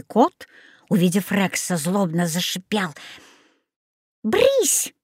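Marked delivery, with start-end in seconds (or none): 4.2-4.9 high, sharp shooing; stern. A narrator's reading. Old woman shoos her hissing cat, stern. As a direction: shoo it away strictly. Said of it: "строго сказала старушка".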